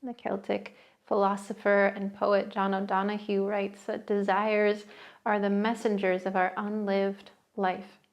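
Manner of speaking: soothing guided meditation voice. Acted. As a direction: settle the listener in, unhurried. Leads a seated meditation.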